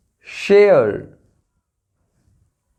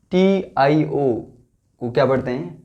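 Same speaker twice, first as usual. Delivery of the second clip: ordinary talk, nothing marked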